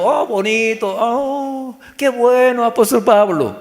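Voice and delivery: hammed-up admiration; admiring listener. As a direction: gush as an easily impressed listener. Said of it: "este mensaje escuchando".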